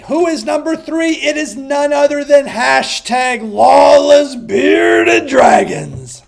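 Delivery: hype countdown. Real, not acted. Host hyping his fantasy league rankings, building to shouts. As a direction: announce the rankings like a hyped host. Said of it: "I get a little too excited when I say that name".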